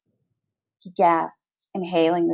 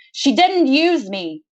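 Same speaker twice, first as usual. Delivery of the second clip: screaming; 5 semitones higher, slower than usual. A narrator's reading. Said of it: "I'm screaming now".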